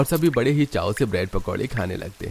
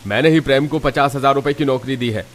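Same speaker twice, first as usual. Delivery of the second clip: loud, high, forceful scolding; indignant boss putting accusers in their place; angry rebuke; 4 semitones higher, 6 dB louder than usual. Acted. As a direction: shout the mob down. Employer halts mob and rebukes them.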